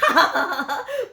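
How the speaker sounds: a high, loud laugh; mocking high laugh; high burst of laughter. Crowd laughing at the nickname.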